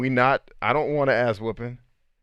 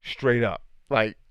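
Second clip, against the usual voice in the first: usual speaking voice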